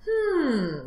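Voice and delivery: long, falling hum; pondering hum; musing to himself. Acted. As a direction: mull it over like a fox. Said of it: "the fox said to himself".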